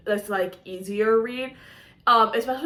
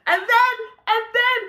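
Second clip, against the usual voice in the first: high, eager "and then, and then"; breathless anticipation; excited; 11 semitones higher, 3 dB louder than usual. Real, not acted. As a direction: pitch up with excitement. Excited storytelling build.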